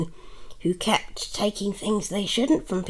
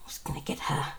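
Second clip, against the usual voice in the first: whispering; 3 semitones lower, 4 dB quieter, faster than usual. Acted. Aside, mother whispering to listener.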